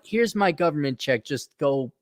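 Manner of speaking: normal talking voice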